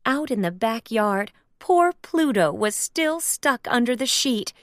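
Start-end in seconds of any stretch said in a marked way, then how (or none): none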